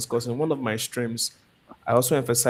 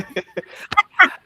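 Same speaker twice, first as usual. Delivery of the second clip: laugh; 13 semitones higher, 7 dB louder than usual. Real, not acted. Amused laugh at teasing question.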